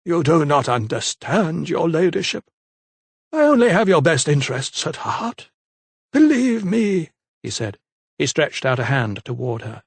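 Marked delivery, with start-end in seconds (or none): 0.0-7.1 a hint of a smooth, pleading vicar; lightly voiced vicar. A narrator's reading. Vicar coaxing the trapped lady, lightly voiced.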